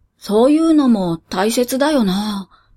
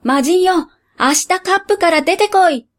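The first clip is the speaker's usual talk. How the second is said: shouting; 6 semitones higher than usual. Acted. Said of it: "僕は願い事を叫んだ".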